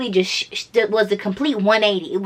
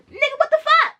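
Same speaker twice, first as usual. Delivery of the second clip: very high, squeaked outrage; shocked son blurting back at his father; mock shocked Harry. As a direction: squeal it in disbelief. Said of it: "Harry was like".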